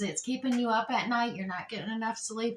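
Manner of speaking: normal talking voice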